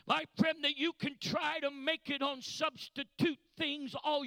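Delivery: relentless loud sermon climax; full-force preaching. Real, not acted. Preacher warning a friend about substitutes, building full-force preaching. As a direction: build to a shouted, rhythmic peak.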